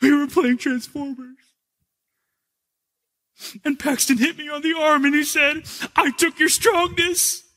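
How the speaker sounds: put-on high, earnest kid recounting a hurt; little boy voice. Son telling dad what happened, little boy voice. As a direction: talk like a wounded little boy. Son explains arm story.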